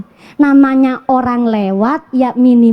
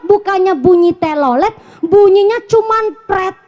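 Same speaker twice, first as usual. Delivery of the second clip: ordinary talk, nothing marked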